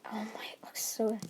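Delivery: hungry whisper. Retold or imitated. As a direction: breathe it out, tempted. Deku craving human flesh.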